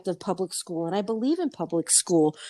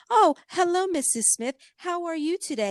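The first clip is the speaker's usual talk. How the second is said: put-on high, overly polite child voice; prim little girl; 8 semitones higher than usual. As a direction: mimic a too-mature schoolgirl greeting. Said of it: "allow my son to walk up to her and say".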